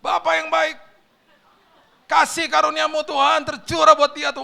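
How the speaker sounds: high, loud impassioned praying; fervent prayer. Retold or imitated. Preacher praying aloud for the courier, fervent shouted prayer. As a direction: cry out the prayer at full force. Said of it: "Ya udah saya berdoa".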